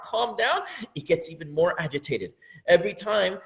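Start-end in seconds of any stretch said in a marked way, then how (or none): 0.0-0.6 urging calm. Pretend. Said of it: "every time you tell your heart".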